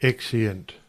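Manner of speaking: usual speaking voice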